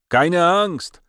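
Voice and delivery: high, loud call back; shouted reply. A narrator's reading. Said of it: "odkrzyknął mi już z dworu".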